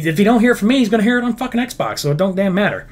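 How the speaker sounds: ordinary talk, nothing marked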